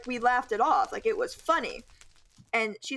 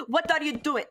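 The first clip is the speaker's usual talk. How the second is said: put-on higher teacher voice slipping into Russian accent; botched impression that turns Russian; accidental Russian accent; 6 semitones higher than usual. Student mimicking teacher, accidental Russian accent. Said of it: "no, that sounds Russian".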